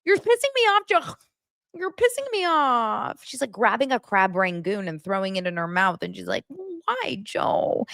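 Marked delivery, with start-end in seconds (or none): 0.0-3.2 angry fiancée. Retold or imitated. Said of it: "Don't yell at him". 6.5-7.9 high, soft mock wail; whining fiancée. Retold fiancée whining at Joe.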